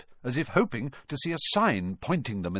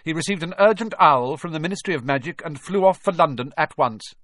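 higher, louder, brisk authority; cold; 6 semitones higher, 6 dB louder than usual. A narrator's reading. McGonagall explains Dumbledore's absence, coldly. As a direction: inform them with cool formality. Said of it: "she said coldly".

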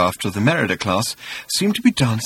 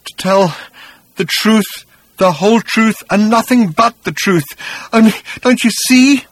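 high, halting, building to desperate; shivering distress; 10 semitones higher, 6 dB louder than usual. A narrator's reading. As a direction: break it into pieces, rising louder. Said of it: "he paused and shivered".

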